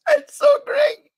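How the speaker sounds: high, crying with laughter; laughing. Real, not acted. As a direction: squeal it out through laughter. Laughing at the owner's reply.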